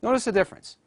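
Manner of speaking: usual speaking voice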